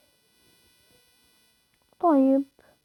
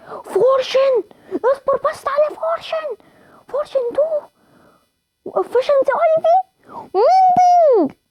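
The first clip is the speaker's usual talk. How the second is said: high, slow, crying-out squeals of delight; ecstatic squeal; 11 semitones higher, slower than usual. Real, not acted. Gamer reads enchantments to friends, squealing with delight. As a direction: squeal each enchantment name in disbelief.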